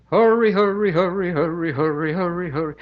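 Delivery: high chanted American R in hurry; American accent. Shown as a demonstration. Showing the choir's chorus, American accent. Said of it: "do it your American way first".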